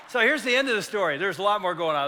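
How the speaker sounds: ordinary talk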